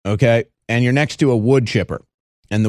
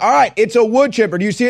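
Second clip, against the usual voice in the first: exasperated proof; 8 semitones higher, faster than usual. Retold or imitated. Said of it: "you're like".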